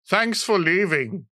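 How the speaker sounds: mock heckler, drawn out slow; heckler jeer. Heckler jeering at comedian on stage, slow mocking. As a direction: voice the heckler at the back.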